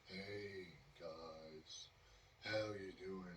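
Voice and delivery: low, soft, dragging greeting; slowed speech. Retold or imitated. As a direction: greet friends in slow motion. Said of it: "He was very slow, uh, in his speech".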